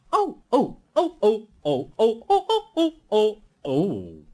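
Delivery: high squealing cries of alarm; silly trucks crying out in a fluster. A narrator's reading. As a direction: shriek like startled trucks. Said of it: "the trucks would scream out".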